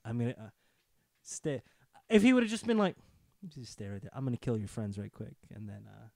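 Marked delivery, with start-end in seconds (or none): 3.5-6.1 low, very soft menace; talking softly. Acted. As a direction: murmur the threat, low and unhurried. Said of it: "If he just talked that softly".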